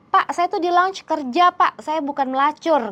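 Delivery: high, upset protest; hurt protest. Retold or imitated. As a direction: plead with wounded pride. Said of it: "shock gitu dan sedih banget". (protes sedih dengan suara tinggi)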